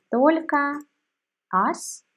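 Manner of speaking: ordinary talk, nothing marked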